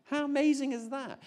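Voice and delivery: delighted, high exclamation of amazement; amazed. Real, not acted. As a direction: let the wonder leap up high. Marvelling at answered prayer.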